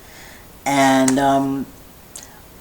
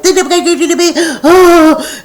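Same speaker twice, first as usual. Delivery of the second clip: imitating a high, loud, startled "Oh" with a laugh; shocked gasp; 13 semitones higher, 8 dB louder than usual. Stylist reacting to the cut on a customer's nose, high startled "Oh". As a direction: mimic the stylist's alarmed little cry.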